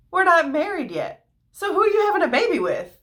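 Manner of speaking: high, rising alarm at the news; shocked disbelief. Retold or imitated. Joseph confronting Mary about the baby, shocked. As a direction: demand answers, caught off guard.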